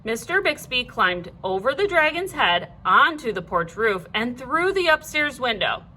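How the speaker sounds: ordinary delivery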